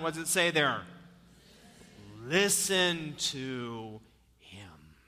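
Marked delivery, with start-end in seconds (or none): 2.2-5.0 slow hushed whisper of God's voice; whispered command. Acted. God speaks from the cloud in a slow whisper. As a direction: breathe it out like a voice from the cloud. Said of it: "Greek word for shh".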